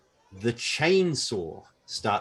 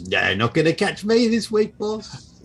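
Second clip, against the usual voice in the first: cheeky pig; 10 semitones higher, 5 dB louder than usual. Acted. Pig speaks to bugbear.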